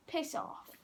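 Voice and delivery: unvoiced whisper. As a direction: breathe it out like a secret. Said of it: "and I was like".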